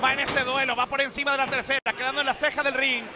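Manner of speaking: high-energy live ringside call; wrestling commentator. Acted. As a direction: call the action as it happens. Live play-by-play of a match.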